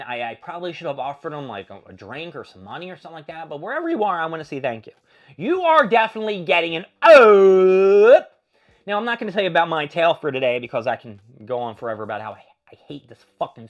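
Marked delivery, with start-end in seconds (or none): 5.4-8.4 slow, booming announcer proclamation; announcer verdict. Acted. Host thanking the helpful stranger in a booming announcer voice. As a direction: boom it out like a game-show host.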